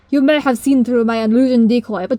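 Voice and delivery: loud hammy cartoon baddie; mustache twirling villain. Identical ugly pony.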